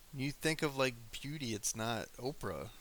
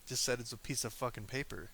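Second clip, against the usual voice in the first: ordinary delivery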